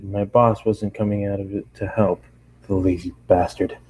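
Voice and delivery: ordinary delivery, nothing marked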